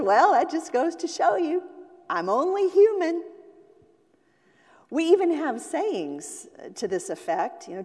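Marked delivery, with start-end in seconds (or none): 0.0-3.2 higher, louder grandma voice; a grandma shrugging off her failed cake; laughing grandma. Retold or imitated. Grandma to her shocked family, higher and louder. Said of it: "she just laughs and says".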